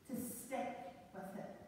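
a near-whispered resolve; hushed. Real, not acted. Sermon climax, softened line.

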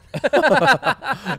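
loud, high laughing fit; cracking up out loud; burst of laughter. Real, not acted. Host cracks up reading his own fake review, loud burst of laughter.

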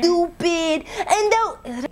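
sudden loud high self-scold; self-scolding snap. Real, not acted. Catching her daydream, she snaps at herself loudly. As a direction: snap at yourself out of nowhere.

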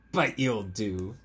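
slow, gentle and fond; tender frog line. Acted. Frog tells dog he'll do, slow and tender. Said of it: "And he said".

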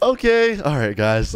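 ordinary delivery

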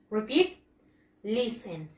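usual speaking voice

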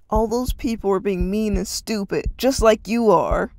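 loud angry shout. Acted. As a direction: throw it back in his face. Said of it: "Arden shouted".

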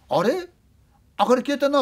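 puzzled question as the lights go out; puzzled director. Retold or imitated. Director noticing the lights gone, startled and high. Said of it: "って言ったんだ。 ディレクターが".

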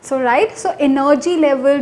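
ordinary talk, nothing marked

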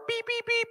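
high-pitched beep beep beep like a door alarm. Retold or imitated. Preacher mimics the church door alarm as he sneaks in, high beeping.

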